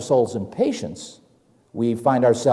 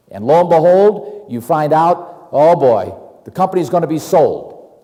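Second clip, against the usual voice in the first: ordinary talk, nothing marked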